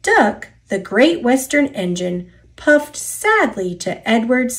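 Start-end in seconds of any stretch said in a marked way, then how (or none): none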